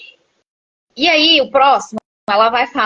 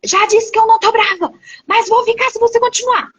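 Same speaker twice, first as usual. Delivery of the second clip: high, loud, exasperated scolding mother; mother's voice raised high and loud in irritation; mother snapping angrily; 10 semitones higher, 3 dB louder than usual. Retold or imitated. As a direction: snap at the child like an irritated mom who insists she isn't mad. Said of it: "A mãe esbraveja".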